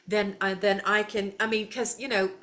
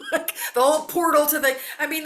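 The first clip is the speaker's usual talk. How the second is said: laughing; 6 semitones higher, 6 dB louder, faster than usual. Real, not acted. Laughing about portals movie trope.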